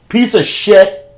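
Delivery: playful furious shout; shouted insult. Real, not acted. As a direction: let it fly at Luke through the door. Muttered, then shouted at Luke.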